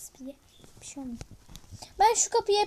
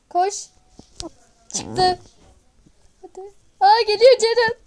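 being chased; 4 semitones higher, 4 dB louder than usual. Real, not acted. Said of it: "Ceren direkt çıkıyor, dikkat et".

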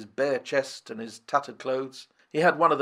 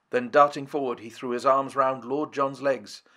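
plain reading voice

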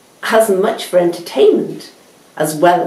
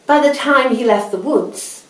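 ordinary delivery

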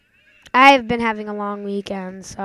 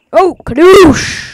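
loud, slow, stretched Kadoosh; drawn-out catchphrase yell; 6 semitones higher, 15 dB louder, slower than usual. Real, not acted. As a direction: savour the catchphrase. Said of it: "It's my thing".

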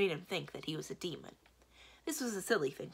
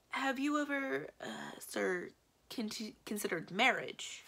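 higher-pitched, halting suggestion; hesitant; 6 semitones higher, slower than usual. A narrator's reading. Secretary suggests marriage to his master, hesitant. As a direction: stumble tentatively towards the delicate word. Secretary broaches a delicate subject.

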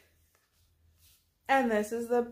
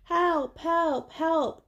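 high, falling storybook cries for help; little truck's cry; 6 semitones higher, slower than usual. A narrator's reading. Blue stuck beside the dump, high cry. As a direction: call out in a small high voice. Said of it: "cried the Little Blue Truck".

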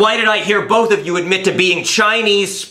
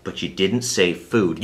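ordinary talk

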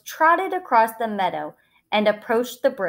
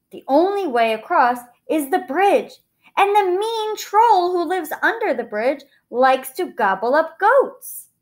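worried middle goat; 9 semitones higher, 6 dB louder than usual. A narrator's reading. Middle goat warns of troll.

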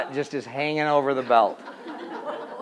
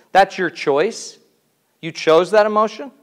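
ordinary delivery

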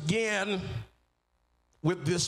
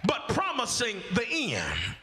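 rising, shouted preaching, pitch up; preaching at full force; 8 semitones higher than usual. Acted. Preacher retrying the point with full force to stir the church. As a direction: build it up and bring the fire. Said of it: "Let me try it again".

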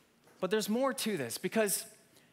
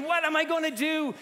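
panicked thought; 7 semitones higher, 5 dB louder than usual. Retold or imitated. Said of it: "And I'm thinking".

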